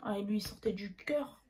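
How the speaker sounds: hushed, whispered aside; low, breath-only remark; whispered jab. Real, not acted. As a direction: drop into a whisper as if sharing gossip. Mock-confiding jab at her ex.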